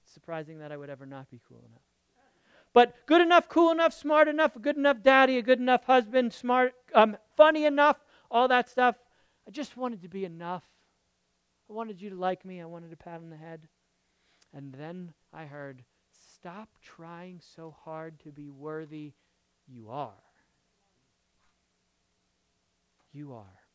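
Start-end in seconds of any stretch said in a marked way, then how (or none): 2.7-9.0 high, loud, insistent run of items; worked-up, driven listing; frantic striving list. Real, not acted. Preacher piles up his old strivings. 16.3-23.6 quiet, unhurried words of acceptance; gentle reassurance. Retold or imitated. Retells the reassurance he heard.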